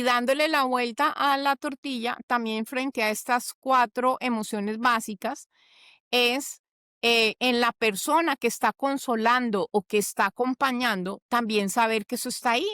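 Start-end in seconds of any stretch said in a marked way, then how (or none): none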